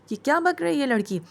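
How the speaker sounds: mock outrage at a stranger's claim; aunt snapping at the stranger claiming to be her daughter-in-law; indignant outburst. Aunt scolding the new bride.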